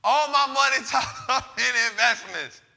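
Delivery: line broken by a laugh midway; laughing through it. Real, not acted. Host repeating his excuse to the audience, laughing midway.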